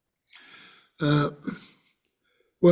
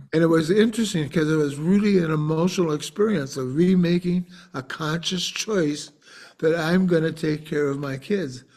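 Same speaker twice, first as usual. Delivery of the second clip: usual speaking voice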